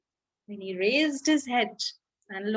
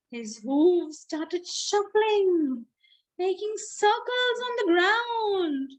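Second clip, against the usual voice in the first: slow, drawn-out narration; 4 semitones higher than usual. Shown as a demonstration. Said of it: "slow demo of the dance words".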